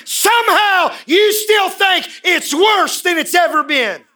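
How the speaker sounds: climbing high and loud to a shouting peak; full-force preaching. Acted. Pastor shouting at the church that life isn't worse, loud. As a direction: build it up to a shout.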